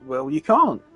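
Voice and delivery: usual speaking voice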